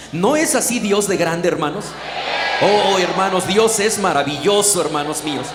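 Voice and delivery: loud preacher's acclaim; fervent exclamation. Real, not acted. Preacher praising God to the brethren, fervent exclamation. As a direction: proclaim it to the congregation at volume.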